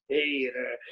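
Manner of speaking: low attempt at a Deep South greeting; half-hearted Deep South accent. Shown as a demonstration. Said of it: "Deep South accent".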